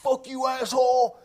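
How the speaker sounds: loud, high, rude shout; mock rude yell. Acting out a crude insult, shouted with mock rage. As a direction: bark it at someone like a jerk.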